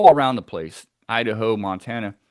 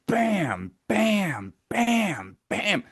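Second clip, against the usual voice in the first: high, falling "bam" for each knock; imitated tree knocks; 10 semitones higher, much slower than usual. Camper mimicking powerful knocks echoing from a tree, imitated banging. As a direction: hit four separate, high-pitched bams like powerful knocks. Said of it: "power knocks, knocks on the tree".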